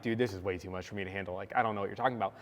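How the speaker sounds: low, soft, fast mutter; overwhelmed mumble. Retold or imitated. Narrator overwhelmed by safety lecture, fast low mumble. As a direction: rush it under your breath.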